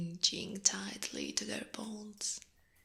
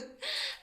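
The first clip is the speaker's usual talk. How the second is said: amused villain giggle. Acted. Arachne amused by the look on the man's face, villain giggle. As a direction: giggle at your prey's reaction.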